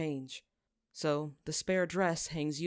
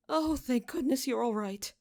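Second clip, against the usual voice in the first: higher, relieved noblewoman's voice; lightly voiced mother, gushing with relief; a hint of a fussing mother; 8 semitones higher than usual. Relieved mother hugs her son.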